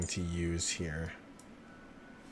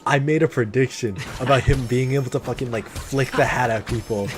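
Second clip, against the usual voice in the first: louder, higher, thrilled rush; telling chat his prediction came true; excited; 3 semitones higher, 5 dB louder than usual. Real, not acted. Streamer hyped to chat, louder and higher.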